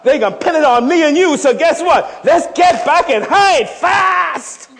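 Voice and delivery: loud, high, driving sermon voice; full-force preaching. Acted. Preacher rousing the church, loud and high. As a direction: preach it hard to the congregation.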